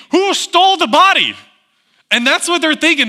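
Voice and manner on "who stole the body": high, alarmed panicked cry. Acted. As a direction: blurt it out in a panic. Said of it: "you'd be thinking".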